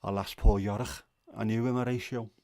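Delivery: hammed-up Scouse Shakespeare; Scouse accent. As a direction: declaim Hamlet in broad Scouse. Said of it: "it wouldn't work in Scouse".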